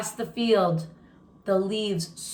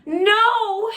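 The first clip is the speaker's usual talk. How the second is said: sudden high, loud cry of despair; Henry hearing his family was sold, crying out; anguished cry; 12 semitones higher, 6 dB louder than usual. A narrator's reading. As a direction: scream it out in disbelief. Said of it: "Henry cried".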